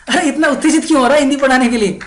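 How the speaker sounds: high, loud, very fast burst; excited mock question. Asking a friend why so excited to teach, acted excitement. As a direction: rattle it off in amazed excitement.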